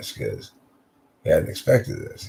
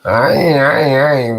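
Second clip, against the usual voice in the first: loud, high flustered stammer; Jerry Lewis stammer; 7 semitones higher, 8 dB louder than usual. Acted. Fabian, flustered at the door, stammers like Jerry Lewis. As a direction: sputter like Jerry Lewis caught out. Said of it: "Jerry Lewis maneuvers where he goes".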